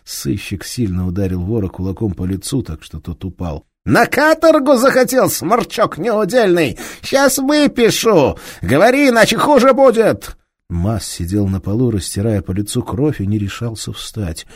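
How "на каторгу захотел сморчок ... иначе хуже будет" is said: higher, louder furious bellow; angry detective shouting down a thief; enraged police inspector. Acted. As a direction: roar at the thief with fury.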